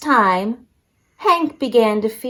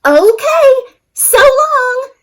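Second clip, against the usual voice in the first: much higher, louder sing-song goodbye; cheerful Rosie; 14 semitones higher, 4 dB louder, slower than usual. Acted. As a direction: chirp it out happily as Rosie. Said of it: "said Rosie cheerfully".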